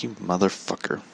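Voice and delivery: put-on hushed swearing at the kid; muttered curse. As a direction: mutter it softly, just to yourself. Private reaction to the complaining kid.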